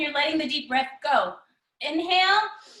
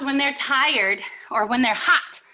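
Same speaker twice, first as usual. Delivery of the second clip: ordinary talk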